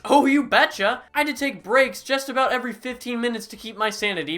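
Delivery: usual speaking voice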